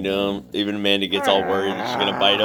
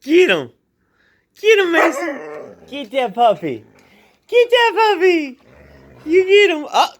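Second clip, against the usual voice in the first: much higher, louder egging-on voice; hyping the dog; 5 dB louder than usual. Real, not acted. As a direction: pump the dog up to pounce.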